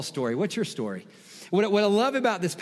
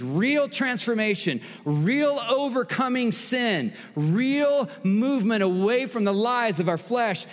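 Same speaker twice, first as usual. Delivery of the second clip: emphatic preaching; 5 semitones higher than usual. Real, not acted. Preacher urging church toward real life change, emphatic preaching. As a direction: preach it in rising, insistent waves. Preacher builds list to a climax.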